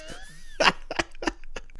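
airy unvoiced laughter; breathy high laugh. Real, not acted. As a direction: wheeze with laughter. Laugh after joke.

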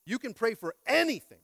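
loud, high scripture proclamation; preaching a Bible verse; preached scripture. Acted. Quoting scripture with force.

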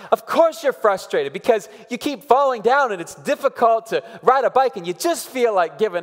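put-on match the kid's frustrated energy, fast and loud; matching her frustration. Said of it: "Rachel will do this. She'll go".

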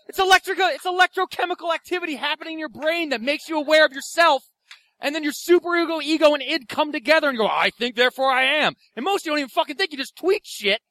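loud, high-pitched comic tirade; exasperated rant. Acted. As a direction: lecture them at full, irate pitch. Ranting back at soul insult.

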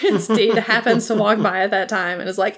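normal talking voice

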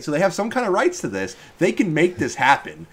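really carried away, pitch rising as you go; getting too excited. Real, not acted. Fan pleading for Knight Solaire in Smash, excited. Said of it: "I'm getting too excited".

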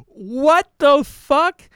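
high, slow, loud disbelieving what the fuck; stunned outrage. Real, not acted. Reacting to the senator's gun claim, stunned and loud. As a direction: drag it out in outraged shock.

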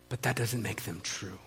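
soft, low and steady; calm answer. Retold or imitated. Sister answering her brother's anger, calm and soft. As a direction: answer the outburst very quietly.

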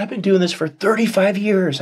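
high, choked-up voice of a crying doctor; retelling a doctor's tearful words, high and emotional. Retold or imitated. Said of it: "And she starts crying".

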